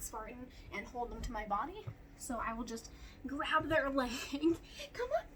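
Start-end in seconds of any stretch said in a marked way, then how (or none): 4.3-5.2 high dog-coaxing voice. Real, not acted.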